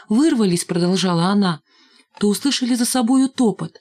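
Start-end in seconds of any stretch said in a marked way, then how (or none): none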